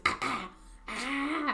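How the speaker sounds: slow, drawn-out mock throat clearing. Girlfriend teasing him about the throat clearing before his videos, slow mock throat clearing. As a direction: scrape the throat slowly, three times. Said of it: "the befores of these videos where he goes".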